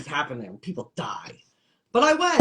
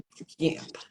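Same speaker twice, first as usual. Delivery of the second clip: a knowing whisper that lands the joke; whispered punchline; 7 dB quieter than usual. Real, not acted. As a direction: whisper "It's a camp" as a secret aside. Whispered punchline repeating the word.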